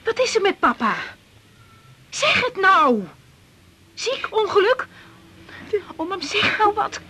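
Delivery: high, pressing, frightened questions; frantic daughter begging her mother to speak; alarmed panic. Acted. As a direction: demand the news in rising panic. Alarmed by bad news of father.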